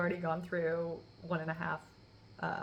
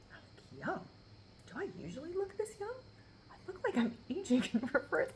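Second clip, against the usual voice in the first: amazed at herself; 7 semitones higher than usual. Retold or imitated. Self-admiring quote, far, laugh.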